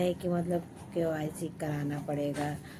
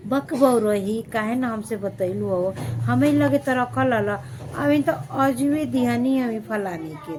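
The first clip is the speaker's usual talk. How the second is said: higher, much louder teasing neighbour voice; playful false scolding, sing-song; mock sweet scolding; 5 semitones higher, 7 dB louder than usual. Another neighbour pretending she'd have helped, loud mock-sweet. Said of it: "वही बोलेगा".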